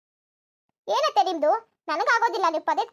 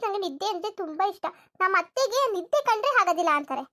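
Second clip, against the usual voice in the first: ordinary talk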